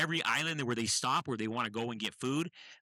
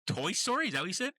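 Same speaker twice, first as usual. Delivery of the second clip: surprised; 8 semitones higher, faster than usual. Real, not acted. Disbelieving echo of a title.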